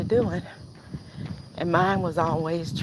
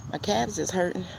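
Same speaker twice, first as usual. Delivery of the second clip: ordinary talk, nothing marked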